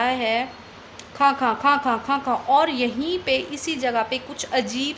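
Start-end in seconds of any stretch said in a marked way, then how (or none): 1.2-2.4 spoken mock coughing खां खां खां; imitated cough. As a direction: act out the nonstop coughing fit. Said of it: "खांसी ने ... पकड़ा". (खांसी की नकल करते हुए खां खां)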